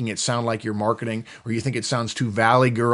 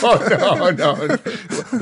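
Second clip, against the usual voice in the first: real laugh through the words, high; laughing protest; 7 semitones higher, 5 dB louder, much slower than usual. Real, not acted. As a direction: protest while cracking up. Laughs at being named himself.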